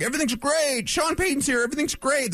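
high-pitched mock enthusiasm; mock gushing booster. Host mimicking Broncos coverage, mock gushing. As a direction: parody relentless positivity, pitched well up. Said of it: "people that are just like".

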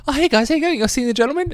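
high-pitched, friendly customer greeting; retold over-friendly hello; chirpy greeting. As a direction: greet them in a bright high voice. Greeting familiar customers in a story.

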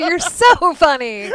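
high, slow, laughing simper; hammed-up wifely giggle; mock movie housewife. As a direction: gush like an old-film wife. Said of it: "And she'll go".

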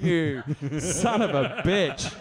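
slow, drawn-out curse; half-laughing outrage; mock cursing. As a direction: savour the swear in exasperated realisation. Realising he had been lied to.

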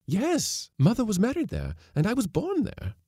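high, eager answer; thrilled to confirm it; excited. A narrator's reading.

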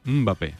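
"Mbappé" with a short soft hum before "ba-PÉ". Shown as a demonstration. Showing cohost how the French say it, soft M. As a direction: start "Mbappé" on closed-lip "mm", no vowel before it. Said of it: "sería algo así como".

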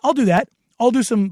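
normal talking voice

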